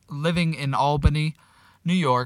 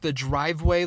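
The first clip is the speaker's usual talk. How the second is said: ordinary delivery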